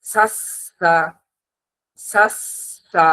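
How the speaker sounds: "সাস, সা" with thin th-like s, air escaping; tongue tip lightly at upper teeth, breath flowing through the স of "সাস, সা"; airy lisped s. Shown as a demonstration. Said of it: "মাঝখান দিয়ে বাতাস বের হবে".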